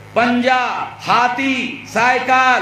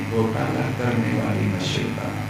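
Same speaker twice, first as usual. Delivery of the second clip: hushed request; 10 semitones lower, 7 dB quieter than usual. Retold or imitated. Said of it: "धीरे बोल रहे हो".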